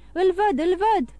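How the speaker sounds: high, urgent shout of discovery; excited cry. A narrator's reading. Voice outside the door spots Andrea, crying out excitedly. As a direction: cry out on sighting the fugitive. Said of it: "strigă o voce în fața ușii".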